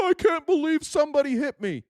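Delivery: hint of a wounded crybaby; mock whine. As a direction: whimper it in mockery. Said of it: "and they're like".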